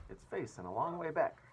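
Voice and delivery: ordinary delivery, nothing marked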